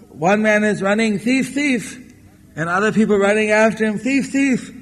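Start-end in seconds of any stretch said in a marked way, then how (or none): 1.2-2.0 high-pitched call at modest volume; mock distant cry. Runner's cry, retold in miniature. 4.0-4.7 high, held-back calling; mock chasers' cry. Chasers' cry, retold in miniature.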